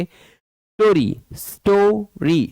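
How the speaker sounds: STORY said clearly as स्टो-री; teacher sounding out स्टोरी syllable by syllable; syllable demonstration. Shown as a demonstration. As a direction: break स्टोरी into two even beats. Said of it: "पढ़ना है जोर से".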